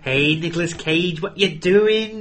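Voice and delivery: hammed-up Manchester villain; Christopher Eccleston. Imitating the actor's villain, broad Manchester accent. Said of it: "doing a main Manchester accent".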